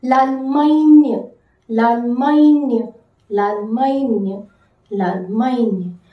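L'Allemagne drawn out slowly, lal-man-yuh; slow French model. Shown as a demonstration. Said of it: "How to say Germany?".

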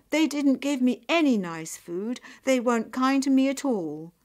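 loud, heated grumbling; indignant complaint. Acted. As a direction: rant about how badly you were treated. Nyap complains bitterly about her sister's house.

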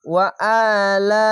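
ওয়া আলা chanted with a long "aa"; short ওয়া, then draw out the vowel of আলা; slow recitation of ওয়া আলা; one-alif stretch. Shown as a demonstration. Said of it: "এক আলিফ টেনে পড়বেন".

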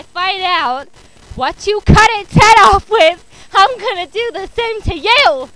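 menacing, emphatic, increasingly loud; shouted threat. Acted. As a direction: build into a furious teacher's yell. Teacher threatens the class.

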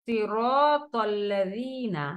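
সিরাতাল্লাযীনা slow, both heavy letters full. Shown as a demonstration. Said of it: "দুইটা heavy letter কিন্তু". (সিরাতাল্লাযীনা দুই heavy অক্ষর মোটা করে)

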